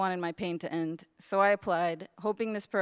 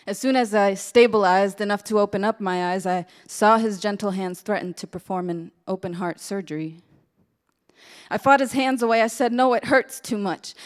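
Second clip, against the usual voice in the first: forceful, rhythmic spoken-word delivery, punching key phrases; spoken-word poem; 8 dB louder than usual. Acted. Intern performing her testimony of what the Lord did, impassioned spoken word. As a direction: perform it as impassioned verse, loud bursts easing at line ends.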